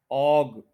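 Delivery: ऑग held long as AWG; stressed syllable. Shown as a demonstration. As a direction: put the weight on ऑग. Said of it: "ओ जी पे stress है".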